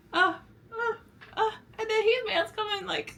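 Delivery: hyped-up, jittery stammer; too much caffeine, can't sit still. Real, not acted. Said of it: "way too jittery".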